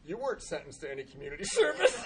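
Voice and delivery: high-pitched acted disbelief; mock incredulous clerk. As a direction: point out the absurd mistake, pitch climbing. Baffled court clerk retold.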